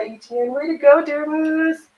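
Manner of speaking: ordinary talk, nothing marked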